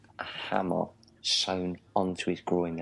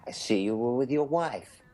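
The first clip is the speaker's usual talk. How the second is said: raised-pitch mock English toff; English accent; 6 semitones higher than usual. Englishman greeting Southerners about wives, in higher caricature English accent. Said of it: "a man from England".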